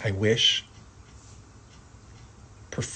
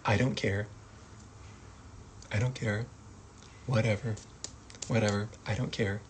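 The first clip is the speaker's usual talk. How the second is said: dismissive; 8 dB quieter than usual. Shown as a demonstration. Acting out indifference.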